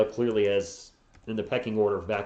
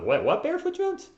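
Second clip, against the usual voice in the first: surprised. Real, not acted. Reacting to a chat name.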